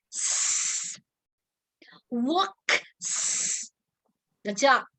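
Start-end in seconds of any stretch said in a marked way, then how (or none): 0.1-1.0 s alone, unvoiced hiss; just the hiss of s; hissed s sound. Shown as a demonstration. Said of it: "Ví dụ, work".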